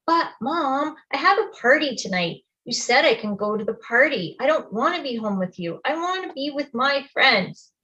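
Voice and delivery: swooping, complaining whine; whining. A narrator's reading. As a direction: whine and plead your case like a put-out kid. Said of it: "I am whining at her".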